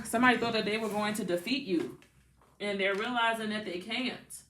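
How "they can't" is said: softer, southern-drawled "can't"; southern accent. Real, not acted. Reader telling Pisces they're realizing it, southern accent. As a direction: stretch "can't" into two southern syllables. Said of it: "Somebody here could have a southern accent".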